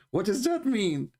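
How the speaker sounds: high, slow incredulous question; mocking disbelief. Real, not acted. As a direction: ask it as if it's absurd. Mocking the verse.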